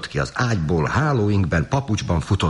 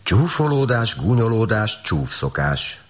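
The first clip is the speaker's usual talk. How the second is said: high start, stepping down word by word; lightly acted reproving dove; chiding; 6 semitones higher than usual. A narrator's reading. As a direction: scold in a list, falling in pitch. Dove scolds the dog.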